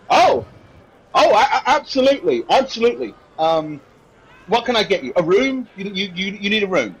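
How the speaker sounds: a hint of a busy innkeeper caught off guard; flustered innkeeper. Voices the innkeeper.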